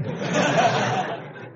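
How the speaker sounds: airy high giggle; laughter at the angels' plight. Real, not acted. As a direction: burst into high breathless laughter. Laughter bursts out.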